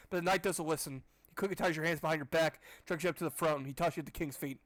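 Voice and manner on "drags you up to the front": ordinary delivery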